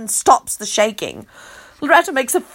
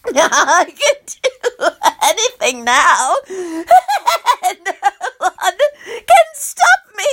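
slow, laughing with malicious glee; evil cackling; 6 semitones higher, much slower than usual. Acted. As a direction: cackle through it like a villain. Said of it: "cackling and chattering with malicious glee".

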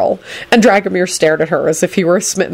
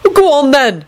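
high, raised holler; 10 semitones higher, 3 dB louder than usual. A narrator's reading. Neville calls after his departing toad, hollering. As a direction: call crossly after someone leaving. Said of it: "Neville hollered after him".

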